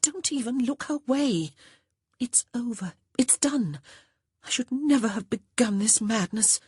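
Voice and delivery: anxious, hushed pleading; agitated young woman. Acted. Honor begs George to leave Cleburne alone, anxious.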